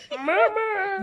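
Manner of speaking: loud, hammed-up call for mum; mock labour cry; Darth Vader on gas and air. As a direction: imitate the gas-altered voice wailing. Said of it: "your voice goes really deep".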